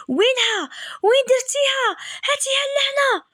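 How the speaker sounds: high, frantic and breathless; panicked demand. Retold or imitated. Panicking man demands wife hand over mask, frantic high voice.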